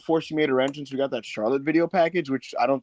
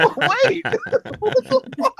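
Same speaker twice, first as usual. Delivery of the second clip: high, loud, laughing fake shock; mock surprise; 4 dB louder than usual. Replying to the R. Kelly quote, in high mock surprise. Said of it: "And it was like".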